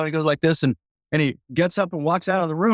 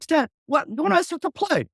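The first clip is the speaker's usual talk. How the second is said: baffled; 11 semitones higher than usual. Retold or imitated. Confused plea to Stan.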